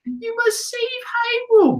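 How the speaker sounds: put-on very high, slow, tearful little girl pleading; little sister voice. Little sister asking Link to save Hyrule, very high and tearful. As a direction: beg like a small sister in a Zelda game. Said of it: "when I use that voice".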